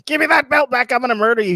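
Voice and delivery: high-pitched, urgent mock threat; mock furious threat. Acting out how Hook hasn't been, heated high-pitched threat. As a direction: act out an irate wrestler's demand. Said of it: "Hook hasn't really been like".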